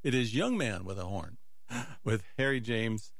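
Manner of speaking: ordinary delivery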